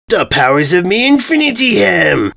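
high, loud proclamation; a pompous invader screams his boast; villain's scream. Acted. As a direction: shout it like a triumphant villain. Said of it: "he screamed".

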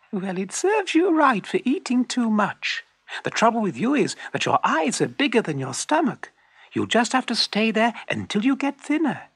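high-pitched, lecturing weasel voice; scolding little weasel. A narrator's reading. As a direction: tell off the fox in a high little voice. Weasel scolds the greedy fox.

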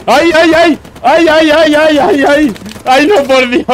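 very high, loud shrieks of panic; shrieking panic. Real, not acted. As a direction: shriek helplessly at the screen. Watching player about to die. (chillidos de pánico muy agudos)

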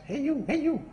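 soft, high parrot call; talking parrot. Acted. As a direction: call out in a small bird voice. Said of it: "the same thing happens".

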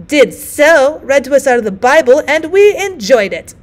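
put-on high, loud old woman yell; screaming. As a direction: yell back at her. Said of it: "screamed the first".

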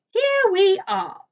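high, loud, slow announcement; drawn-out, fluty call; cried out. A narrator's reading. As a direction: sing out the arrival grandly. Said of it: "cried Mister Wonka".

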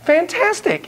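high bright praise; excited praise. Acted. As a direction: cheer her on, way up high. Praising child's toy.

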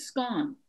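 "scone" said to rhyme with "gone"; short-O scone. Shown as a demonstration. Caller teaches the host the word, "scone" rhymed with gone. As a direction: say "scone" with an open short vowel. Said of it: "tell me how to pronounce that word".

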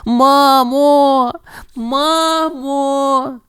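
high, desperate puppy cry; calling out. A narrator's reading. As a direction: call mom across the street. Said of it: "гукаючи маму". (цуценя розпачливо гукає маму)

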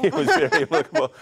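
high, loud, laughing reply; real laughter riding over the words; laughing agreement. Real, not acted. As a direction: burst out laughing while agreeing. Joking about useless German degree.

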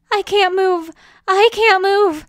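high, loud panicked wail; whimpering. Acted. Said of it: "Quixotic whimpered".

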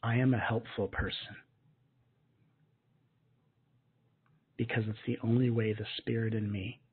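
affirmation to repeat. Shown as a demonstration. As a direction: lead them gently, line by line. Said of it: "repeat after me".

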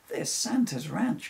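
spotting the ranch, a touch of excitement; excited cry. A narrator's reading. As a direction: Rudolph calling out the sight eagerly. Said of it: "cried Rudolph".

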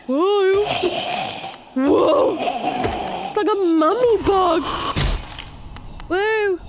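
loud startled exclamations; loud, surprised gamer yelps; startled whoa. Real, not acted. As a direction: jump back in surprise. Surprised at a mummy bug.

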